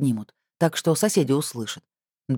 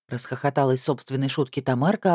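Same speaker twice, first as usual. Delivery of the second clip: ordinary delivery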